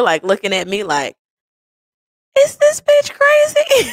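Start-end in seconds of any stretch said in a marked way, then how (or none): 2.4-3.9 very high, loud, drawn-out disbelief; high-pitched incredulous voicing of a thought; incredulous squeal. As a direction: squeak it out in mock shock. Imagined look of disbelief, voiced.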